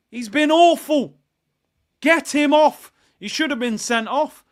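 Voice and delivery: loud, high exasperated yell; angry shout. Real, not acted. Fan demanding Martinelli be taken off, angry shout. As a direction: demand his removal at full volume.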